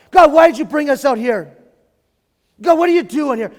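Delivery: loud, high angry complaint; angry at God. Retold or imitated. Worshipper angrily confronting God in the desert. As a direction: hurl the question at God. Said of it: "Mad at God".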